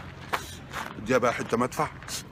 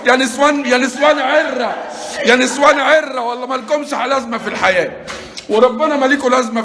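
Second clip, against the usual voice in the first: high, loud furious yelling; angry shout; 6 semitones higher, 3 dB louder than usual. Real, not acted. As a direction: let loose on them in a rage. Angry at being scared. (زعيق غاضب وهو بيشتم اللي خضوه)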